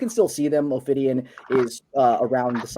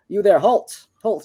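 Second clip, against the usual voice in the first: loud, high call to halt; calling to stop; 6 semitones higher, 7 dB louder, slower than usual. Acted. Engineer calling the robot to halt, loud and high. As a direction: shout it out to stop him. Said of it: "he goes ... telling you to stop".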